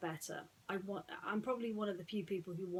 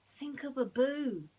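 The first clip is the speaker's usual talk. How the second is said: slow, higher, coaxing; Aladdin, pleading; 7 semitones higher, 7 dB louder, slower than usual. Acted. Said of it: "Aladdin told her".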